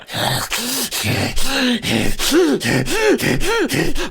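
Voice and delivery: high, drawn-out mocking giggle; high creepy giggle. The slime thing in the basket laughing at Kat, high creepy giggle. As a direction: giggle like a gleeful little monster.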